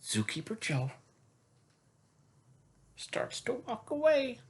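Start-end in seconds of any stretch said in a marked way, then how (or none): none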